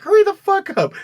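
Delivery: high, loud, panicked hurry-up; mock frantic yell. Dealer yelling at his friend to hurry. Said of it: "He's like".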